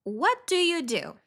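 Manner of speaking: ordinary talk, nothing marked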